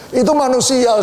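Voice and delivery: ordinary delivery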